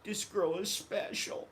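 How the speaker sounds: soft, slow, high and dreamy; dreamy sigh. Retold or imitated. Thinking of the girl he left, slow soft longing. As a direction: say it like you're still in love.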